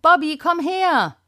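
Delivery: high calls across the garden; calling out. Acted. Children search the garden for the budgie, high calling. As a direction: call the lost bird, voice pitched high.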